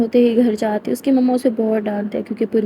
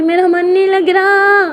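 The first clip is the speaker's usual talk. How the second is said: high, loud and slow, near tears; whiny wail; 8 semitones higher, 6 dB louder, slower than usual. Acted. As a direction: wail like a sulking girl who misses someone. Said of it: "चीख क्यों रही है?". (ऊँची, रोनी-सी शिकायत भरी आवाज़)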